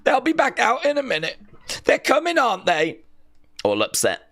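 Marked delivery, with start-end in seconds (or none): 0.0-3.0 high, fast, mock-tearful pleading; hammed-up teary high voice; mock crying girl. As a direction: imitate a crying girl clinging to hope, pitched way up. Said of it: "crying and she's like".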